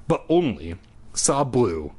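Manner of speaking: ordinary delivery, nothing marked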